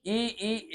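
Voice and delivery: ई held long and soft to show the tongue tip; ई sustained as a tongue-position demo; demo vowel. Shown as a demonstration. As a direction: stretch ई out so they feel the tongue forward. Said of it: "क्या?".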